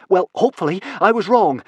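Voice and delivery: high, loud, gasping reply; out of breath, voice pushed up; gasped. A narrator's reading. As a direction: gasp it out urgently. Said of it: "gasped Fishlegs".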